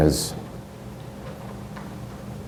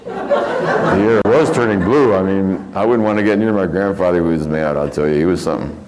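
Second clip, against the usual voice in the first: ordinary talk, nothing marked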